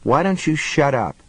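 usual speaking voice

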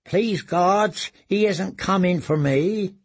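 desperate prayer. Acted. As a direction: pray he passes you by. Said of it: "he prayed".

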